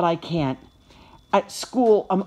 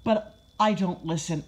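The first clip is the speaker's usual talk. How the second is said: ordinary delivery, nothing marked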